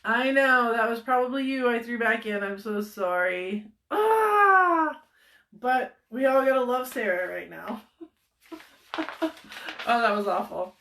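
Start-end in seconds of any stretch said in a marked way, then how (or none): 3.9-5.0 excited shout. Real, not acted.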